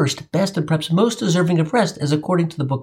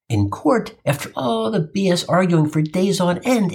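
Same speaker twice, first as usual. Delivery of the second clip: ordinary talk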